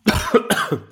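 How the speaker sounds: sudden hacking cough after swallowing wrong; choking cough. Real, not acted. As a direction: cough hard like something went down the wrong way. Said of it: "Hab ich verschluckt".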